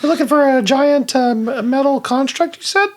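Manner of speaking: high-pitched helpful plant; talking plant voice. Acted. Plant checks what the druid seeks, high voice. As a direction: ask in a high little plant voice. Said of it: "let me do the voice for this".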